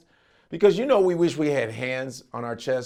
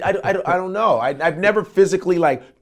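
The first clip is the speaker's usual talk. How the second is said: usual speaking voice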